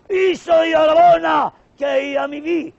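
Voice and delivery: high, loud proclamation; loud high declaration; pasha proclaiming. Acted. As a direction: announce the reward grandly.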